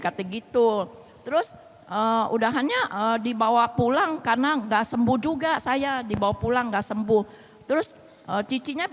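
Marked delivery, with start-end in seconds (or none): none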